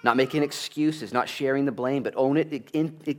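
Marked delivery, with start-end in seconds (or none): none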